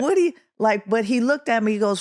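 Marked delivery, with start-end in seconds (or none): 0.0-0.3 very high incredulous squawk, cut off; high rising mock disbelief; astonished. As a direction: imitate a flabbergasted father. Acts out Pops' astonished reply.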